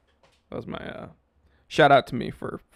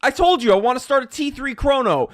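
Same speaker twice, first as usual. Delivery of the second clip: loud, higher hammed-up yelling; mock shouting; 8 semitones higher, 7 dB louder than usual. Vlogger shouting his watch rules at viewers, hammed up. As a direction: bark the rules again in mock fury.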